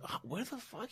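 hushed mock bewilderment; bewildered. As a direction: mutter in sheer confusion. Third surprise meeting.

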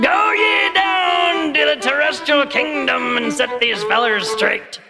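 high, slow, shouted divine command; booming God voice. Pretend. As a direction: bellow orders from the heavens. Mock God commanding disciples.

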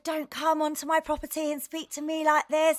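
mock furious telling-off; angry rant. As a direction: tear into him. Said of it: "I went absolutely mental".

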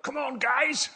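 high, rousing preacher's call with a poorly faked South African accent; Ann Bellingham. Preacher telling church how a colleague rips it up, high mock rallying cry. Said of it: "I can't do the South African accent well enough".

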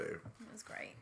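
breathy whispered aside; hushed whisper before the show. Real, not acted. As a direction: whisper it off-mic.

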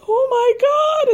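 high, loud, stretched mock wail; mock crying wail. Mimicking Sheree crying on the show, mock wail. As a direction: ham up a teary gasp. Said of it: "and, like, crying".

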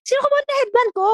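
mild mock yell of a child searching; noisy calling out. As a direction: shout around looking for it. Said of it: "ang ingay ko sa labas".

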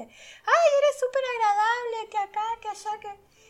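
voz muy aguda y fuerte, efusiva; halago efusivo imitado. Retold or imitated. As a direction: imita un elogio exagerado en tono altísimo. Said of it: "mucha gente me dice". (gente que la elogia, voz muy aguda y efusiva)

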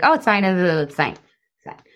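quick dismissive reassurance, fading away; brushing it off. Acted. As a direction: wave it away, trailing to almost nothing. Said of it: "we either ignore the fact".

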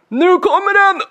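put-on high, loud gleeful kid cry; boy warning his uncle in the car; excited announcement. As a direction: shout it like a boy about to pull a prank. Child announcing the fart.

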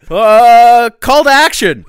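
big booming announcement; announcer voice. Acted. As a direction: call it out like a hype host. Announcing the call to action.

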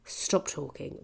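soft, weary "stop talking"; subdued exasperation. Retold or imitated. Comic to French man talking during the gig, quietly exasperated. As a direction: say it low and quiet, fed up.

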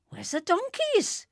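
very high-pitched character question; piping query. A narrator's reading. Mister Weasley looking for seaside donkeys, piping up. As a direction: pipe it up high.

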